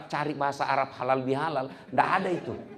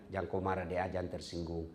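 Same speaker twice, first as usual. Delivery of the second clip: soothing boss; 8 semitones lower, 9 dB quieter than usual. Acted. Director soothes worker, gives money.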